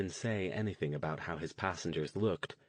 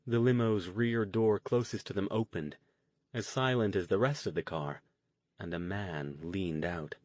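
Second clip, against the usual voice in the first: ordinary delivery, nothing marked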